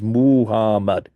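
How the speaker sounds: Muhammad with its u, a, a vowels drawn out; vowels emphasized. Shown as a demonstration. Lecturer showing the name's three vowels, Muhammad said with each vowel emphasized. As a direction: stress every vowel in Muhammad: u, a, a. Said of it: "I'm emphasizing the u, a, a".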